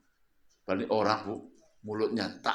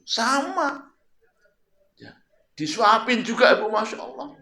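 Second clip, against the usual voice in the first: high excited exclaim; 12 semitones higher, 4 dB louder than usual. Acted. Exclaims same treatment.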